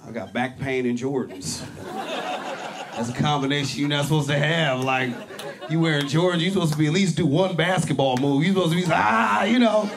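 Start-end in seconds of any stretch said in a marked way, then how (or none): none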